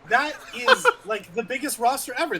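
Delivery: laughing. Real, not acted. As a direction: laugh through the words. Laughing after the nice joke.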